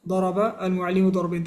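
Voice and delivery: fast, fluent second run of ضرب المعلم ضربين; quick repeat. Shown as a demonstration. Teacher repeating the example for students at natural fast speed. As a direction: rattle it off quickly now. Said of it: "if we say".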